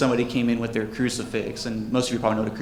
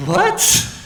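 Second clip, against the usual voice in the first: high, loud squawk of disbelief; incredulous what; 6 dB louder than usual. Acted. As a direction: burst out a baffled, high-pitched reaction. Acted baffled reaction to customer requests.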